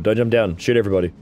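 ordinary talk, nothing marked

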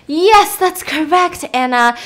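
high, loud, drawn-out praise; mock-enthusiastic teacher. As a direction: applaud the pupil as a pretend dad. Pretend dad praising the pupil Anna.

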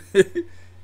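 a loud, genuine laugh that breaks out; real laugh. Real, not acted. Reacting to friend's twerking video.

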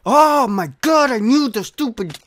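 very high, loud rage at the controls; frustrated wail. Real, not acted. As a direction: wail in gamer fury, almost crying. Rage at analog stick failure.

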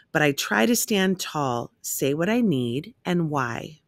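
ordinary delivery, nothing marked

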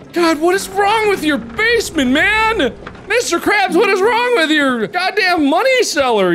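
high-pitched, worked-up complaint; exasperated rant. Real, not acted. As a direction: berate the boss in disbelief. Berates Mister Krabs after a scare.

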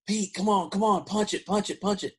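high, excited urging to punch it; urging Pete on. Retold or imitated. Friends in the back seat egging Pete to speed, high and eager. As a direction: egg the driver on, high and eager. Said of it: "we're like".

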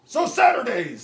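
loud announcer hype; monster truck announcer. Acted. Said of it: "like the monster truck announcer guy".

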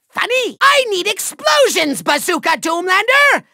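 normal talking voice

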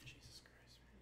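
exasperated whisper. Real, not acted. Exasperated aside.

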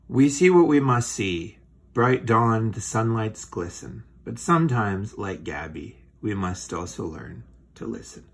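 loud, declaiming poem reading; poem recitation. Acted. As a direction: recite it like a moral verse. Reads the closing poem.

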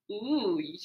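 ordinary delivery

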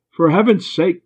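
exasperated outburst; exasperated shout. A narrator's reading. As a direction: shout it out in frustration. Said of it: "Brewster shouted".

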